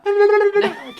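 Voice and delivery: high, slow, laughing strain. Shown as a demonstration. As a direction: push the voice up and fail. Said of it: "My voice don't go high no more".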